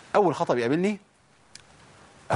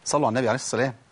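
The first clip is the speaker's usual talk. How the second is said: ordinary talk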